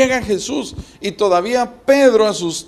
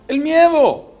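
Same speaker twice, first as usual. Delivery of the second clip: higher, louder punchline answer; emphatic answer; 8 semitones higher, 5 dB louder than usual. Real, not acted. Preacher answering what sank Peter, raised and louder. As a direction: hit it hard like the verdict.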